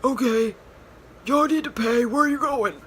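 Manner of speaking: high, loud put-on demand; imitating the attendant, high and loud; parking attendant. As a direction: call after them like the stern attendant. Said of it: "was like".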